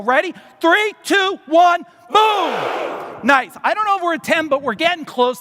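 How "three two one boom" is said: higher, louder countdown exploding into boom; all-out shout. Real, not acted. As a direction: shatter the room with the boom. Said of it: "I need you to yell, "Boom," so loud".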